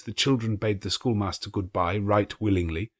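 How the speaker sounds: usual speaking voice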